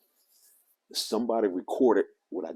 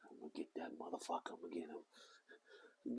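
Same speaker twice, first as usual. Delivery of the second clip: very soft, hushed imitation of a kid muttering; muttered threat; 19 dB quieter than usual. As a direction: mutter it under your breath like a kid chasing. Kid muttering threat while chasing.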